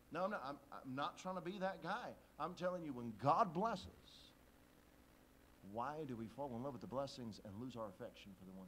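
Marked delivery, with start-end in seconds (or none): 2.9-4.3 louder, very slow, each word stretched; slow emphatic. Real, not acted. Preacher pressing the point on his congregation, slow and loud. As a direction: lean hard on "when God blesses".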